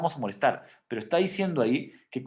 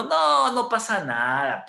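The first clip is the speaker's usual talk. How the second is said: higher, louder and slow, mock carefree dismissal; careless shrug-off; 8 semitones higher, 5 dB louder, slower than usual. Preacher mimics a believer who ignores God's command, mock carefree dismissal. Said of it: "cuando yo digo".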